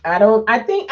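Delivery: ordinary talk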